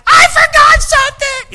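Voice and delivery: very high, loud shout of alarm; panicked cry. Retold or imitated. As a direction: yell it in sudden panic. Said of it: "somebody's gonna say".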